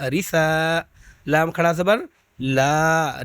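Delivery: ordinary talk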